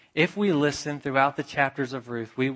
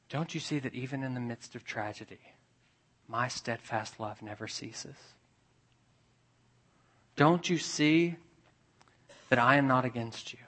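much softer, tender divine consolation; gentle voice of God; 6 dB quieter than usual. Acted. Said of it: "Do you hear the voice speaking, saying".

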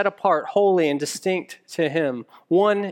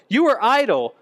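repeated, higher and louder; booming Pharaoh; 6 semitones higher, 4 dB louder than usual. Acted. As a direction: press the accusation hard. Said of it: "the voice of the taskmaster".

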